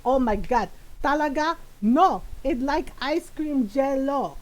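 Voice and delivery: put-on exasperated Filipino-accented mom, drawing out the words; mom's Filipino accent. Mom insisting on her word, in her Filipino accent. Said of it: "in my mom's voice".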